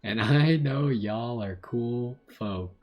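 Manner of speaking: slow southern drawl; southern accent. Acted. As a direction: read the letter like a slow-talking southerner. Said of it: "like a southern accent".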